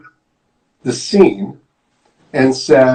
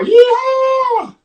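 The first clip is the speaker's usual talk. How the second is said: high, drawn-out yeehaw; cowboy whoop. Acted. Storyteller voices Piggy charging out as a cowboy, whooping. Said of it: "like a cowboy".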